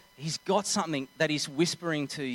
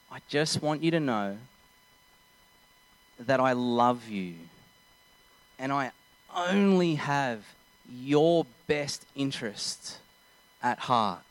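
tearful tenderness; 2 semitones lower than usual. Retold or imitated. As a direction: pause between phrases, gently earnest. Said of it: "Now she's getting tears in her eyes".

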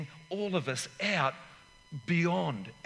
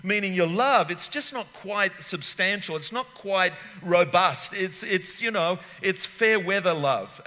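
raised, loud preaching voice; 5 semitones higher, 5 dB louder than usual. Acted. Preacher pressing the congregation, loud and raised. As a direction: preach it out to the church. Sermon delivered with force.

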